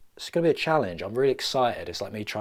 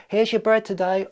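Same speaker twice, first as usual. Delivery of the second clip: Rat Piper; 12 semitones higher, 7 dB louder than usual. Acted. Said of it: "montage of him piping rats".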